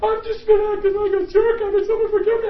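hammed-up high-pitched astonishment; reenacted bewilderment. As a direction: blurt it high, in disbelief. Acts his own bewildered reply.

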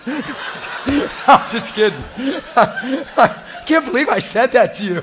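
can barely get it out for laughing; laughing loudly. Real, not acted. Preacher cracking up at his own joke to the crowd.

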